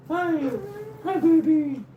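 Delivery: very high, cooing pet voice. Real, not acted. Owner greeting the dog in a very high cooing voice. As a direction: squeal hello to the dog.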